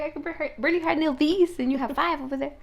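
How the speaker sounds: playful put-on exasperation; mock exasperation. Teasing voicing of friend's reaction.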